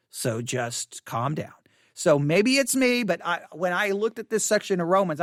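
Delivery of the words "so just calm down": lower, softer, slow; lower calming shush. Retold or imitated. People tell the pastor to calm down, lower and slow. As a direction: slowly soothe him down. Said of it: "people will be like".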